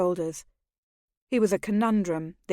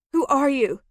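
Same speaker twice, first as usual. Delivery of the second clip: desperate question; 6 semitones higher, 6 dB louder than usual. Acted. She reaches for his mask.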